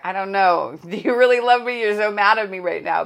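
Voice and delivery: mock doubting daughter. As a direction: play the hurt kid asking if she's still loved.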